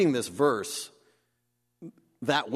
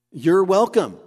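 louder, higher, insisting You're welcome; emphatic reply; 6 semitones higher, 8 dB louder than usual. Acted. Preacher voicing James, insisting firmly on You're welcome. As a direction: lean hard into You're welcome.

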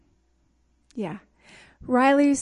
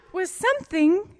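laughing; 5 semitones higher, 5 dB louder than usual. Retold or imitated. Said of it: "she says".

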